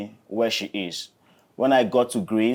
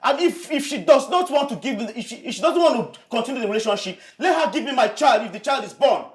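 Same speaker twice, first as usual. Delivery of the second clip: defiant shouting; 10 semitones higher than usual. Acted.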